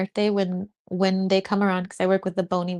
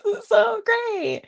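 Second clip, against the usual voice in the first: much higher, slow, half-crying squeal; overwhelmed and teary; 3 dB louder, slower than usual. Retold or imitated. Retelling free lunch at church, high teary overwhelmed squeal. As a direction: squeak it out as if about to cry with joy. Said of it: "I was like".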